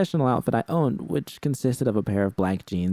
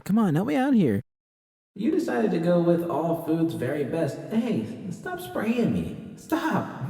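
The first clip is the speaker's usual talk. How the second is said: much higher, startled protest; 11 semitones higher than usual. Acted. V getting sprayed at the sink, high startled protests. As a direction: yelp at the spray.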